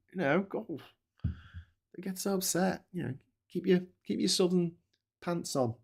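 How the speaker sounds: lightly lampoon the prissy southerner; mocking voice. Streamer mimicking upset southerners, soft high put-on voice.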